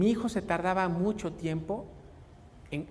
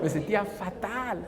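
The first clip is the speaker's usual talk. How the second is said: usual speaking voice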